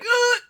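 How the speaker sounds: high, strangled "ugh"; short high-pitched choking noise; choked ugh. Acted. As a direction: gag as if a stiff collar is choking you. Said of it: "starched within a millimeter of their life".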